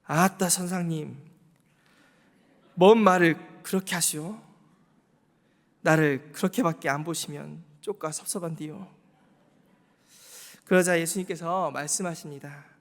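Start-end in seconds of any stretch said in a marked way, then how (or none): 0.0-8.9 hammed-up Jeolla dialect Peter, loud on the protest. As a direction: play Peter as a hurt Jeolla man, louder mid-line. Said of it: "전라도 말로 좀 바꿔보면은". (전라도 사투리로 섭섭한 베드로)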